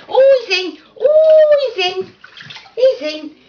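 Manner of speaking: high, loud delighted squeal; thrilled yelp of relief; excited cheer. Real, not acted. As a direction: cheer as the dog finally gets in. Dog finally gets into bath.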